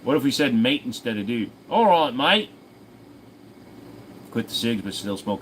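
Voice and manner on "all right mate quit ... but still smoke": a light put-on Australian accent, pitched up; mock Australian greeting to chat; Aussie accent. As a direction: say it like a cheeky Aussie bloke. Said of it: "It's a great Aussie".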